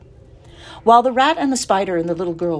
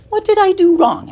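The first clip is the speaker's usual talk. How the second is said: puzzled piglet; 7 dB louder than usual. A narrator's reading. Pig voice after falling.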